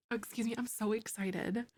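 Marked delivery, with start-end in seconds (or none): none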